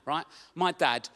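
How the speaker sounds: usual speaking voice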